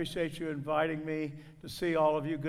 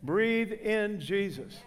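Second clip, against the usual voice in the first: slow instruction; 3 semitones higher, much slower than usual. Shown as a demonstration. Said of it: "This will get you out of covid".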